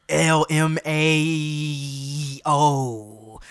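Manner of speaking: letters spelled very slowly. Real, not acted. Spelling out text slang.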